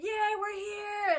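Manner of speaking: high, slow, pumped-up yay; excited cheer. Retold or imitated. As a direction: cheer it like the instructors. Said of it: "they're all pumped and excited".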